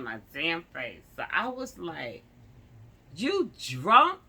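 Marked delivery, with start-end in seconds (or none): 3.1-4.2 high, loud accusing question; incredulous questioning. Retold or imitated. Driver confronts officer smelling of alcohol, incredulous. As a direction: challenge him with a sharply rising question.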